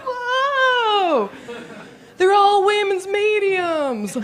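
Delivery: high, slow amazed cry; crowd surprise. Retold or imitated. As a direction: gasp like you just caught a shirt. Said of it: "And you're like".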